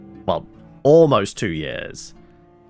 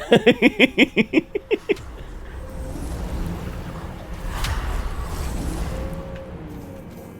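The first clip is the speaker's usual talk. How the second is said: high, breathless giggling fit; long laugh; 4 dB quieter than usual. Real, not acted. Gamer tickled at asking the weeb, long high laugh. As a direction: giggle on and on, trailing into near-silent wheezing.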